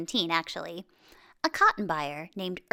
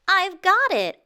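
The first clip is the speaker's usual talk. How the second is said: much higher, louder burst of joy; excited yell; 9 semitones higher, 7 dB louder than usual. Acted. Earl waking Josephine at night, excited yell. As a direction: shout it like the idea just hit. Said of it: "Earl yelled with excitement".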